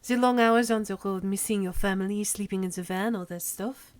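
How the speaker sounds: put-on hammy French accent. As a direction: recall old touring days in a French accent. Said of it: "You enjoy French accent".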